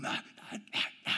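put-on breathless whispered no's from a kid; whispered protest. Little brother whispering no while the other screams. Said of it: "Jordan starts going".

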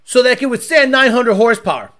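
lightly voiced Ford engineer, fast and eager; hammed-up boardroom question; mock Ford engineer. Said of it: "no stress test at Ford that says".

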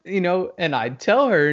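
ordinary delivery

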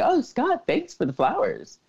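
high-pitched "oh", then settling down; gushing thanks. Acted. Girl thanking the boyfriend for flowers, mock gushing. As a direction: lightly mimic a sweetly grateful girl.

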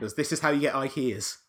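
loud, high-pitched mock-outraged question; mock scolding. Co-host riffing on the censored ending, loud mock scolding. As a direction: call it out like an exasperated scold.

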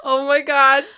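loud high laughing oh my God. Real, not acted. Laughing cry.

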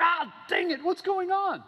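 hammed-up frustrated prophet; frustrated shout. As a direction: shout in defeat at the third blessing. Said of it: "He's like".

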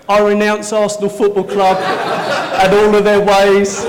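hammed-up renunciation while giggling; playful fake liturgy; mock prayer. Mock prayer renouncing Arsenal.

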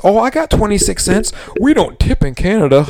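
lightly mock the stingy Canadian; mock Canadian customer. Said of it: "I got a Canadian who's".

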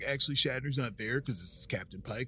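hammed-up surfer dude; bro voice. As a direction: play it like a chill bro. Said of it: "I don't know why you're doing it like bros".

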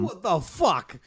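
lightly echoed New York tough guy; Andrew Dice Clay. Acted. Co-host echoing the doctor, mock New York accent. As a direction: parrot the wise-guy swearing. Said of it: "I love Andrew Dice Moore".

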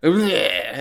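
mock monster "bleh"; creature bleh. Movie fan imitating the creature that appears, mock monster noise.

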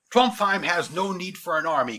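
self-important general speechifying; commanding general. A narrator's reading. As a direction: address the troops with authority. General addresses troops in story.

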